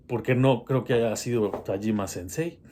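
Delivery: normal talking voice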